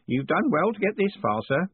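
put-on high, eager old postman. Groat encouraging Moist.

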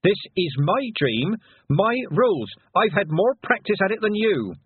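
high-pitched yelling at a whale; insistent, high, scolding yell; indignant yell. A narrator's reading. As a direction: shout orders up at something huge. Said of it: "and yelled at the towering whale".

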